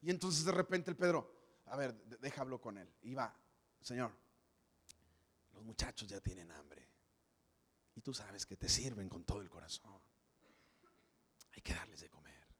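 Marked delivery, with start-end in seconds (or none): 3.8-9.4 meek hushed plea, almost whispered; timid whisper. Acted. Pedro pleading meekly to Jesus. 11.6-12.5 meek hushed plea, almost whispered; timid whisper. Acted. Pedro pleading meekly to Jesus.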